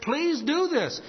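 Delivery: high pleading request; high, slow please. Shown as a demonstration. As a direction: lift it up gently. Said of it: "how the French say".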